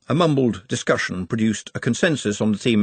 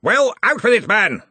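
impatient, louder bark; roar; 12 semitones higher, 5 dB louder than usual. A narrator's reading. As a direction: demand an answer now. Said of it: "roared Ridcully".

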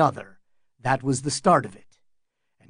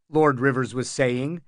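ordinary delivery

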